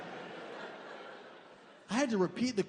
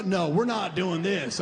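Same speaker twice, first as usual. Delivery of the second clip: ordinary talk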